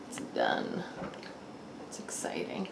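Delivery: breathy hushed thrill under her breath; excited whisper. Real, not acted. Mother whispering to herself as dinner finishes, hushed excitement. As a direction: whisper it like a secret treat.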